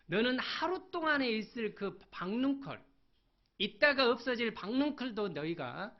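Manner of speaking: high, loud preaching; fiery preaching. Acted. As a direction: drive it home to the congregation. Preaching at full force. (힘차게 외치는 설교)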